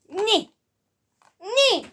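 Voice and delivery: very high, loud mock knight squeal; knights who say nyeh. Reviewer imitating the knights who say nyeh, high loud yelps. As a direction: yelp each word as a hammed-up silly knight.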